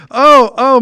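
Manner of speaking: normal talking voice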